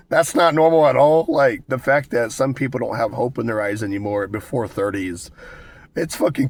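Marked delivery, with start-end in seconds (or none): 0.1-1.2 incredulous. Real, not acted. Laughing disbelief at own eyes.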